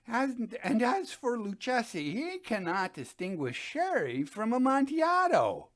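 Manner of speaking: insistent, excited wine snob; eager drunk Fortunato. Acted. Fortunato's voice in the reading.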